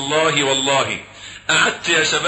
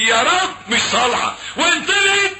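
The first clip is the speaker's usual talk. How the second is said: mock shouted prayer; 13 semitones higher than usual. Preacher mimics hollow prayer, shouting.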